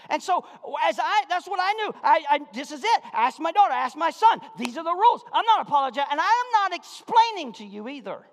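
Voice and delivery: loud, high-pitched preacher's shout; impassioned pulpit holler; full-force preaching. Real, not acted. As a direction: preach it at the top of your voice.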